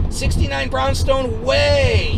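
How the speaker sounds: "Way" stretched long in a high yell; stress on Way. Retold or imitated. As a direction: hammer it home slowly, dragging out "Way". Irate man repeats the correct street.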